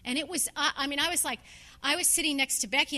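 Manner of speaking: normal talking voice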